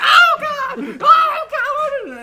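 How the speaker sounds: high, loud shriek of horror; shocked shriek. Retold or imitated. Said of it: "she was like".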